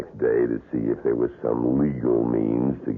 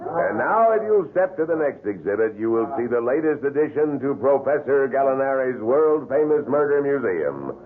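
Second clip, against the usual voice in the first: showman tour guide; 13 semitones higher than usual. Acted. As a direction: sell each horror to the crowd like a showman. Tour guide presents wax murders.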